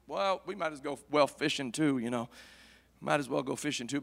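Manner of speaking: low, soft, resigned murmur; resigned disciples. Acted. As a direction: go along with it half-heartedly. Preacher voices the dejected disciples.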